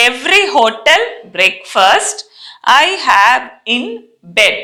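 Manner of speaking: slow, word-by-word sentence for repeating; slow model reading. Shown as a demonstration. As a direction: spell out each word slowly for the class. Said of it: "first నేను చదువుతాను వినండి".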